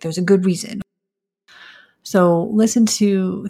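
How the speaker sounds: usual speaking voice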